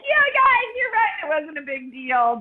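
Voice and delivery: high, mock-casual brush-off; playing it cool. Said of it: "playing it cool".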